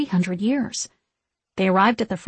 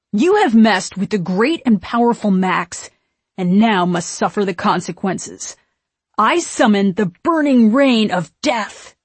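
loud, booming mock-sorcerer proclamation; grand threat; 5 dB louder than usual. A narrator's reading. Max bluffing the witches, proclaiming grandly. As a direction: declaim it like a mighty wizard.

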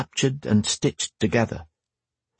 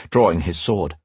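ordinary delivery, nothing marked

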